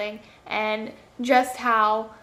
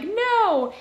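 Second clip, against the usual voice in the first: dismayed cry; 9 semitones higher than usual. Retold or imitated. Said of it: "and I'm like".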